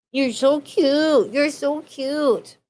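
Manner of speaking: slow doting coo. Real, not acted. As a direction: stretch it out slowly, doting. Baby talk to the pet.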